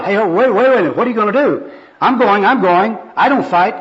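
high, loud protest; hammed-up alarm; alarmed backing-off. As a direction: hastily fend off a pushy preacher. Bum backs off from aggressive preacher.

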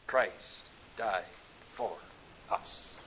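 normal talking voice

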